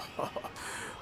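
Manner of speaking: quiet, breathy little laugh; soft chuckle. Acted. Studio owner amused by colleague's question, soft chuckle. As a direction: laugh it off softly.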